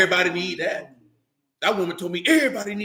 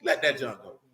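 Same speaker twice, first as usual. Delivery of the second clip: ordinary talk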